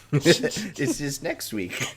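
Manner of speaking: talking through laughter; giggling while speaking; laughing. Real, not acted. Both laughing at the mix-up.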